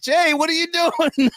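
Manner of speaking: high, slow exasperated plea; pleading with the silent guest. Retold or imitated. Host retelling a guest who sat silent, high and slow. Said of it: "I'm like".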